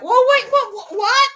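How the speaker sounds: high, loud stammered double-take; retold disbelief. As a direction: squeak out mock bafflement, loudly. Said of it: "I'm like".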